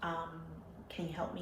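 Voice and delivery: hushed retold confession to a store clerk; discreet request. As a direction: ask softly, just between two people.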